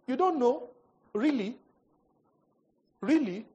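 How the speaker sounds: high, loud sarcastic disbelief; really, you don't know; incredulous mocking. Real, not acted. Pastor mocking the claim.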